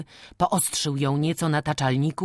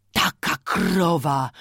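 lamenting; 4 dB louder, slower than usual. A narrator's reading. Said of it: "wyrzekał coraz żałośniej".

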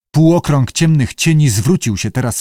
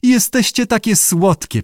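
chirpy little voice; 8 semitones higher than usual. A narrator's reading. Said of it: "szczebiotliwy głosik".

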